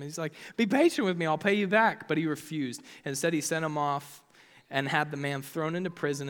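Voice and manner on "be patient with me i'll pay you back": higher, louder acted plea; mock-pleading, pitched up; begging. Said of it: "began to beg him".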